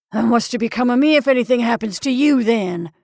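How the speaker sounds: loud, fast, dismayed outburst; dismayed demand. Acted. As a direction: blurt it out in dismay. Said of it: "demanded the dismayed Mister Gribble".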